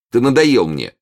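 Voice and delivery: раздражённый окрик; резко оборвать надоеду; нетерпеливо. Acted. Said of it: "крикнул король нетерпеливо". (раздражённо, с нетерпением)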